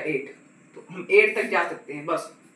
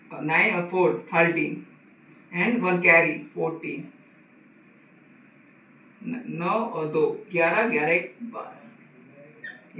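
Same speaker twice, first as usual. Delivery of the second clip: slow, drawn-out counting; slow working out; much slower than usual. Shown as a demonstration. Teacher adding the columns for students, slow counting. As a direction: count it through slowly, step by step. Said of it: "teacher works the sum aloud".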